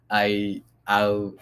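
ordinary talk, nothing marked

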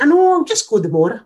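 high, quick, airy dismissal; imitating a mum who won't be bothered; breezy brush-off. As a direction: wave it away in a lifted voice. Mum breezily refuses, retold.

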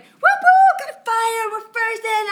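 high, loud excited whooping; mock the eager rookies, high and shouted; young bucks hyped up. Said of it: "all those young bucks are like".

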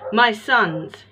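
put-on loud furious roar of a bitter old woodcarver. A narrator's reading. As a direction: bellow it out, old man's fury. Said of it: "he roared".

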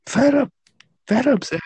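very high-pitched fed-up complaint; comically high exasperation; mock upset. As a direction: protest in a high, hammed-up voice. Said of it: "[name]'s getting upset".

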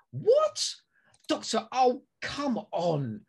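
high incredulous period actor voice; hammed-up disbelief, drawn out; Stephen impression. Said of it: "I can do my Stephen impression".

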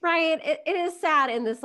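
high, loud, eager reply; excited. Real, not acted. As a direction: burst in eagerly.

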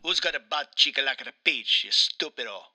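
put-on Italian accent, aggrieved game character; Mario with Italian accent. Mario complains to his interviewer, in a thick Italian accent. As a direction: play an indignant Italian Mario.

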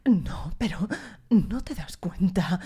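slow, whispered, overacted dub line; mocking a dubbed film character, whispered and slow; mock dubbing actor. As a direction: parody the theatrical way Spanish dubs deliver lines. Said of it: "cuando lo doblan es como".

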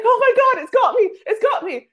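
comic panic, tangled in a rope in the loch; mock panic scream. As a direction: shriek like something's grabbed your ankle. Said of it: "screaming bloody murder".

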